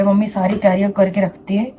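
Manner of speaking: ordinary delivery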